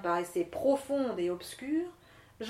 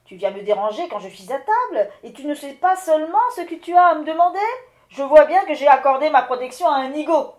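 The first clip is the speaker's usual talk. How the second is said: loud, fast, scolding rant; the wind lord berating a fool, much louder; furious wind lord; 4 semitones higher, 14 dB louder, faster than usual. Acted. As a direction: tear into him for interrupting dinner.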